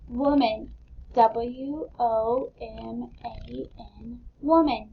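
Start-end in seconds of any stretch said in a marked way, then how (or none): 1.1-4.2 each letter slow and separate; teacher spelling aloud; spelled out slowly. Shown as a demonstration. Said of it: "Let's spell it out loud".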